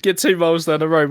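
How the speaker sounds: normal talking voice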